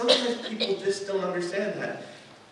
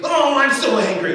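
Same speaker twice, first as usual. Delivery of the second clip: higher, louder mock anger shout; 9 semitones higher, 11 dB louder than usual. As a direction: ham up a frustrated parent's outburst. Mock angry person, louder, higher.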